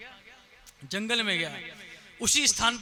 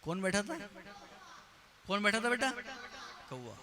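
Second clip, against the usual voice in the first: ordinary talk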